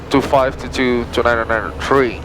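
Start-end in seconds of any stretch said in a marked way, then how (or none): none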